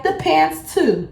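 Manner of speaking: high, drawn-out mocking demand; mimicking coworker. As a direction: stretch it out in a mock high voice. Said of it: "She was like".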